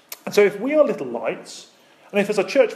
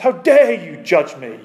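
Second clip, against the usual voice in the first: mock outraged holler, louder; retold holler; 9 dB louder, slower than usual. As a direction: imitate her angry shout at breakfast. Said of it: "she hollered at us".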